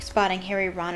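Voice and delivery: ordinary delivery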